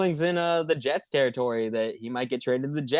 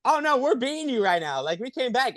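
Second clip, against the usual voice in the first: mock gloating; 12 semitones higher than usual. Retold taunt, high voice.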